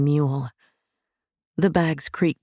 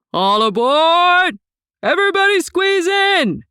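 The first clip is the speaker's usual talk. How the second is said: bellowing conductor; 7 dB louder than usual. A narrator's reading. Conductor bellowing at passengers to board the train. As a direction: bellow it down the platform. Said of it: "he bellowed in Song's ear".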